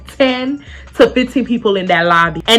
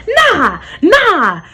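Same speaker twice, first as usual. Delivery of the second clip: retold refusal; 8 semitones higher than usual. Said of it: "He's like".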